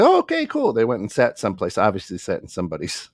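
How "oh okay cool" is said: very high-pitched imitation of agreement; mock breezy acceptance. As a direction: pitch it way up, happily going along. Couple happily accepts the seating advice.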